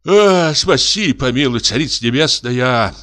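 broken sigh. A narrator's reading. Forester calls on heaven for mercy, sighing brokenly. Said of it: "прерывисто вздохнул лесник".

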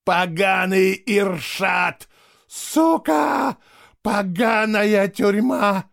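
slow, high-pitched screamed curses; enraged scream. A narrator's reading. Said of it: "закричал он, выплёскивая все накопившиеся эмоции". (яростный протяжный крик)

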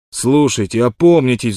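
loud desperate plea; pleading outburst. A narrator's reading. Wild Water begs the partners to relent, loud pleading. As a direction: beg them to see sense. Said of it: "взмолился Уайлд Уотер".

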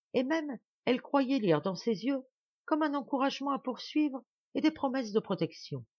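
ordinary delivery, nothing marked

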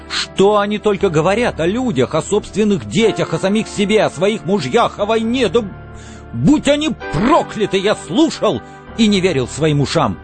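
indignant, high-pitched outburst; outraged rant. A narrator's reading. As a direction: pour out disgust, stressing each item in turn. Montag rants at the women.

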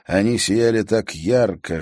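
ordinary delivery

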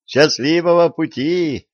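high, gleeful farewell; joyful, laughing little voice. A narrator's reading. Girl seeing off fleeing Germans, joyful and high-pitched. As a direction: call it out brightly from above. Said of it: "послышался сверху радостный смеющийся голосок".

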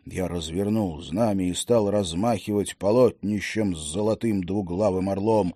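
usual speaking voice

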